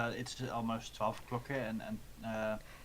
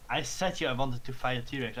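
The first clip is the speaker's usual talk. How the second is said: ordinary talk, nothing marked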